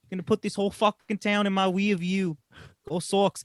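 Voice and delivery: hammed-up thick Boston accent, half-joking; mock Boston accent. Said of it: "I've never seen him talk, talk like this".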